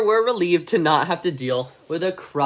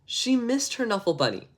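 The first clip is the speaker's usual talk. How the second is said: plain reading voice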